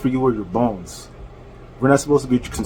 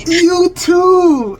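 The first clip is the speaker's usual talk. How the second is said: loud, very high drawn-out jeer; jeering comeback; 6 dB louder than usual. Real, not acted. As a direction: shout it back in a high mocking voice. Jeering comeback during argument.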